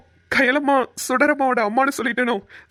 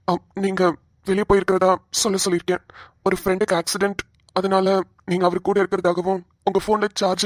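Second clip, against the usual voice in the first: lower, steady officer voice; 5 semitones lower than usual. Acted. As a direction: reassure him as a calm policeman.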